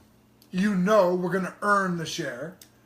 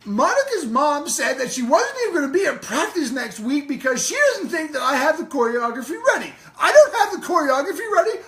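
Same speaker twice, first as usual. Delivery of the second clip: higher, louder, fast indignant tirade; riled-up rant; 9 semitones higher, 6 dB louder than usual. Retold or imitated. Coach fuming off the ice at Monica's mom, shouting. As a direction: rant like a tiny furious skating coach. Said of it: "And then she'd go".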